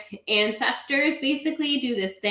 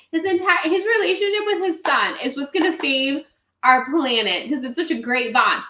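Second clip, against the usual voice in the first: normal talking voice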